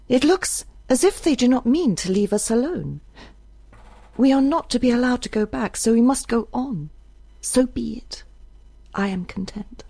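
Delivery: high-pitched voice, firm then resigned; Isbel, resolute young woman. A narrator's reading. Isbel answers Judge about going on, resolute then resigned.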